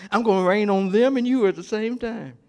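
normal talking voice

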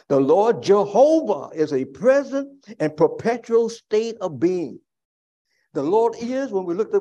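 loud, high proclaiming, slowing at the end; preaching at full force. Acted. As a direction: proclaim it to the whole church.

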